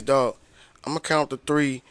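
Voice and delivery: low, soft conspiratorial murmur; hushed plotting. Retold or imitated. Friend to narrator in grandma's back room, a low soft murmur. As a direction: plan the breakout under your breath.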